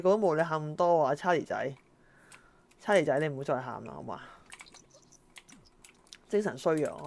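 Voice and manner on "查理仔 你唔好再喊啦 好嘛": ordinary delivery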